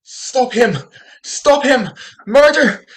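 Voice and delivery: panicked hue and cry; frantic alarm. Acted. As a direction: shout it out to raise the whole street. Raises the alarm on stage.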